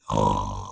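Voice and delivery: a slow breathy snore out through the mouth; snoring breath. Shown as a demonstration. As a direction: breathe out like a sleeping bee snoring. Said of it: "we're going to make a snoring sound".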